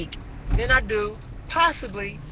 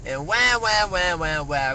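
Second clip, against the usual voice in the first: mock crying wah. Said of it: "we tend to wanna cry".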